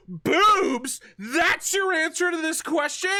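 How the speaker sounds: loud, high-pitched, incredulous game show host; Steve Harvey. Acted. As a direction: ham up the disbelief, drawing out the question. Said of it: "as I ham it up".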